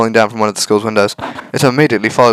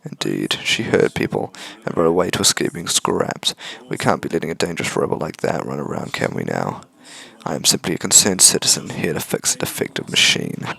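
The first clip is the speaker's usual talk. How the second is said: low soft villain; 5 semitones lower, 8 dB quieter than usual. Acted. Villain justifies hunting robot.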